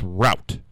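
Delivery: route rhyming with out; "rout" pronunciation. Shown as a demonstration. Host correcting to what it really is, route as "rout". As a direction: say route with an ow vowel. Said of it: "but really it's route".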